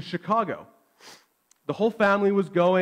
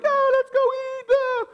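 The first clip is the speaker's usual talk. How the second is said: much higher, eager cheer; excited whole family. Retold or imitated. Family in the parking lot cheering for dinner, excited high. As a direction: squeal it like kids about to eat.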